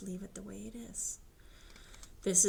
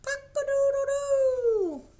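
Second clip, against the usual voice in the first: high, loud rooster crow; 10 dB louder than usual. Pretend. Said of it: "And the rooster says".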